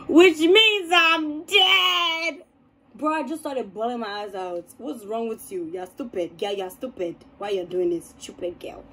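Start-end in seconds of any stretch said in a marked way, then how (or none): 0.1-2.4 high, drawn-out melodramatic cry; mock distressed wail. Storyteller acting out telling a friend sleep means death, mock wail. Acts out panicked past self. 4.8-8.8 muted self-scolding. Retold or imitated. Scolds self while retelling crying.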